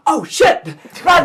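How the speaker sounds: high, loud yelp of alarm; acted panicky kid shout; startled girl. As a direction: shout a sudden warning to the gang. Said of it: "and she goes".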